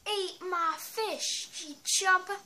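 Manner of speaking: slow high playful taunt. Pretend. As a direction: gloat at the enemy, hamming it up. Taunting the enemy plant.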